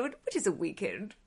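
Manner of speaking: lightly voiced posh aristocrat, soft; Dowager Countess. Host repeating a favourite Downton Abbey line, softly posh. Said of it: "she's, she's so posh".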